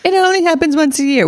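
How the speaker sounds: high, sing-song mock of the in-laws' excuse.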